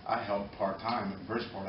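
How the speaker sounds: normal talking voice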